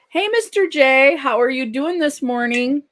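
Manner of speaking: loud, high-pitched hello; big, high, welcoming shout-out; excited greeting. Real, not acted. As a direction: call out brightly to someone just arriving. Calling a greeting to arriving viewer.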